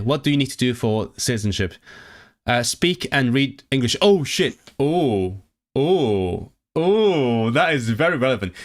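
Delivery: ordinary delivery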